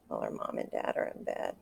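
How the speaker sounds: whispered aside, no voice; hushed whisper about sleeping parents. Real, not acted. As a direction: breathe the words out softly.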